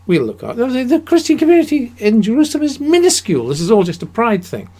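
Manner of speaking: high, fast, put-on mocking voice; sneering at a pride-driven excuse; mock pompous Russia. Lecturer mocking Russia's Jerusalem excuse, high fast put-on voice.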